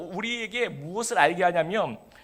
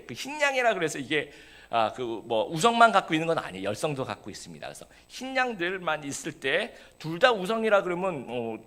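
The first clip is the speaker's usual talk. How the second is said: ordinary talk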